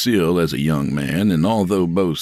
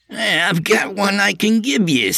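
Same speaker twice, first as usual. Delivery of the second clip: high, eager sidekick trying to smooth things over; Artie's eager voice. Acted. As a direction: pitch it up high as the nervous peacemaker.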